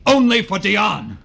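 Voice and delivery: high, loud possessive cry; angry shout. Acted. Writer shouts that the manuscript is only for Dion, angry shout. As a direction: shout it like a threat.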